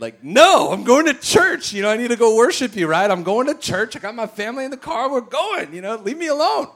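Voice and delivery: loud, high-pitched comic whining refusal; protesting. Retold or imitated. Retelling his protest to God.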